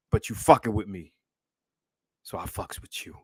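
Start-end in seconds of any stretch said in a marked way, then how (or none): 2.3-3.1 soft whispered thanks. Real, not acted. Streamer thanks Tyrese for the super chat, whispering. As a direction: say it close and quiet.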